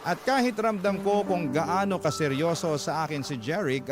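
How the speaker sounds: usual speaking voice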